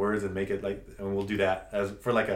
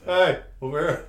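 mock call to someone wandering off; raised, louder beckoning call; 4 semitones higher, 6 dB louder than usual. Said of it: "everybody else is kinda like".

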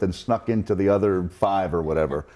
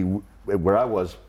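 normal talking voice

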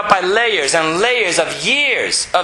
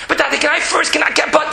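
put-on much higher, eager little girl; child pleading; 8 semitones higher, faster than usual. Daughter stalling her father, high childlike pleading. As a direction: whine like a restless six-year-old. Voicing six-year-old daughter.

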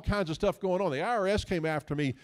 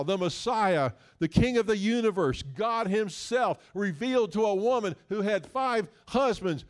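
louder preaching in a building list; impassioned preaching; 5 semitones higher, 5 dB louder than usual. Real, not acted. Preacher proclaiming the Messiah to his congregation, impassioned. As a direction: proclaim the Messiah with rising force.

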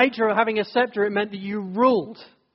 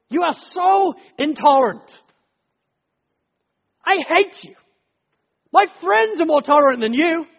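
much higher, louder angry rant; angry tirade; 5 semitones higher, 5 dB louder than usual. Retold or imitated. Someone furious at God, ranting in anger. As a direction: rail at God, hurt and furious.

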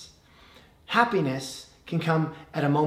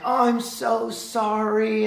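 put-on sorry to baby; 5 semitones higher, slower than usual. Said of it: "and I'm like".

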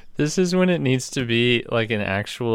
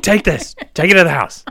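loud, higher barked command; hammed-up frantic hero, louder and higher; mock urgent order; 7 semitones higher, 10 dB louder, faster than usual. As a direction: shove it at the messenger vocally. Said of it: "He's like".

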